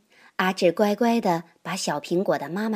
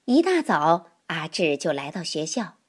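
plain reading voice

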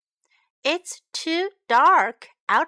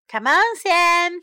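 sighing; 5 semitones higher, 8 dB louder than usual. A narrator's reading. Stella hurries Sam along, mild sigh. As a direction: urge him along, mildly exasperated. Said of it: "sighed Stella".

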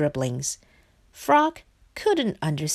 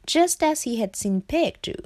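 plain reading voice

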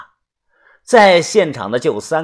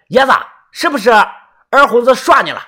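high raised villager voice; shouted question; 7 semitones higher, 4 dB louder than usual. A narrator's reading. Villager shouts a question to Yezi, high and moderately loud. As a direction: call it out over a crowd. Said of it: "有人大喊".

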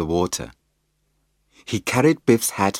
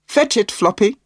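higher, louder call to the dog; 12 semitones higher, 5 dB louder than usual. A narrator's reading. Mum calls Floppy to fetch the stick, loud call. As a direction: call the dog across the park. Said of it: "she called".

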